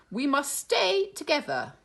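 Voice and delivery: high, firm hen teacher voice; Miss Cluck voice. Acted. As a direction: gather them up like a mother hen. Miss Cluck urging the class.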